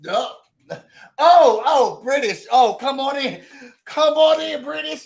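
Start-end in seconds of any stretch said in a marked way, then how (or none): 1.2-5.0 higher, louder, overly sweet host; gushing welcome. Retold or imitated. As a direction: greet her with fake delight. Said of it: "Jackie was like this".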